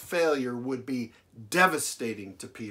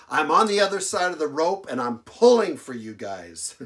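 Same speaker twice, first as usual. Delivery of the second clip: emphatic; 3 semitones higher, 7 dB louder than usual. Acted. Jesus voiced pulling for disciples.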